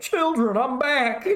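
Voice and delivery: high, sing-out greeting as a cartoon cook; hammed-up Chef impression. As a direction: call out joyfully, hamming up the character. Imitates resurrected Chef's lucid moment.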